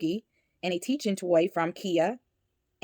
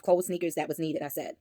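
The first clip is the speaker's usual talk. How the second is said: ordinary talk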